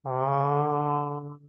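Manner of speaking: long low ah, held; low ah for fourth chakra. Shown as a demonstration. Teacher leading the class in a heart chakra ah. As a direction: resonate a low ah in the chest. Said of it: "this is how my sound would look like".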